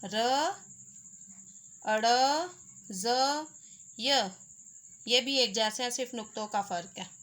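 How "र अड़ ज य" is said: letters said one by one, spaced; syllable drill. Shown as a demonstration. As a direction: sound out each letter for a learner. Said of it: "र का ख़ानदान है". (अक्षर अलग-अलग साफ़ बोलना)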